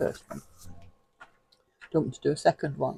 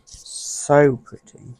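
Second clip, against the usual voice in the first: "so pretty" louder and very slowly drawn out; gushing admiration; 8 dB louder, much slower than usual. Real, not acted. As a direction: stretch "so pretty" with loud delight. Admiring a butterfly picture.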